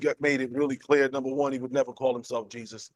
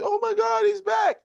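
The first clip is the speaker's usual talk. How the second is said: high, thrilled exclamation; high, giddy imitation of a fan; excited fans. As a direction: gush as if spotting a celebrity. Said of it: "everybody started".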